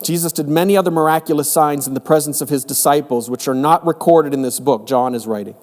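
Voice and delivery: normal talking voice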